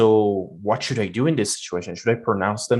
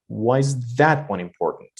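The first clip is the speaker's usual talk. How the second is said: "that" raised and stretched in the question; stress on THAT. Shown as a demonstration. Teacher focusing on that one, stress on "that". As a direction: lean on "that" in "Why is that one important". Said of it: "I'm focusing on that".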